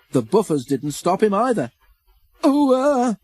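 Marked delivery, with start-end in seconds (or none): 2.4-3.2 high, loud panicked wail. A narrator's reading. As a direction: wail out in alarm. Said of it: "wailed Percy".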